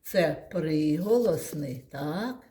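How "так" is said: ordinary talk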